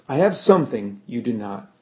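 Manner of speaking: ordinary delivery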